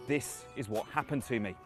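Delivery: ordinary talk, nothing marked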